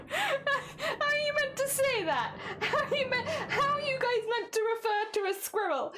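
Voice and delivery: cracking up while asking; laughing disbelief. Real, not acted. Streamer laughing at viewers over the French word, high and giggly.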